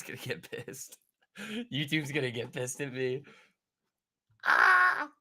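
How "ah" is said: loud, high startled yelp; short sharp cry. Real, not acted. Streamer reacting to chat, high yelp. Sudden outburst.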